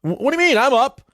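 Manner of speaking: high-pitched mock indignation; defensive protest. Dozer caught by partner on Friday night, defensive protest. Acts out being caught dozing.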